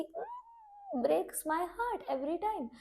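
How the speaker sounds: high, slow, dripping fake pity; mock-sad sigh of pretend sympathy. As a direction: parrot a hollow sympathetic brush-off, drawn out. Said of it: "मुझे सामने से जो reply आया है ना".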